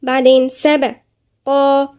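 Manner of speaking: سبقا with the final alif held two counts; drawn out سبقا; tajweed demo; slow سبقا. Shown as a demonstration. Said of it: "في ألف صغيرة تاني مقدار حركتين".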